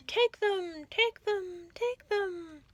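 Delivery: cave echo. A narrator's reading. The cave echo lures the rat inside. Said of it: "came back the welcome sound".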